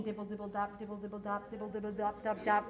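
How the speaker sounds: low, soft, even patter; rain pattering. A narrator's reading. Teacher imitates rain from the book, low soft patter. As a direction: chant it evenly like falling drops. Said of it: "He can sound like the rain".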